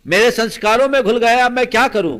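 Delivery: loud, high-pitched imitation of desperation; retold helpless outcry. Man confessing to monk he cannot change, loud despairing outburst. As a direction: cry it out as the man at his wits' end.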